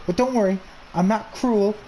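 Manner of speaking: ordinary delivery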